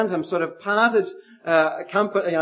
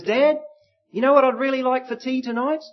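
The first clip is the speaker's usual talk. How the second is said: voicing the son; 8 semitones higher than usual. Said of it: "And the son says".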